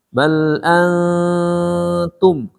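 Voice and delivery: "Bal antum" with a long hum before the T; chanted "Bal antum", nasal sustained; held ikhfa nasal. Shown as a demonstration. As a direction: slowly stretch the n in "antum". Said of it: "Ketemu tak ya".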